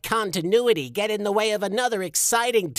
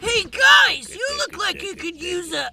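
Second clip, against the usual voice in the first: strange voice; 10 semitones higher, 3 dB louder than usual. Acted. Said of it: "what's going on with your voice there".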